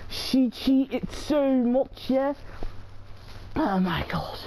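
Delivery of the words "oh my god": low, unvoiced gasp of disbelief; breathless whisper. Real, not acted. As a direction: whisper it, drained after a scare. Recovering after the scare.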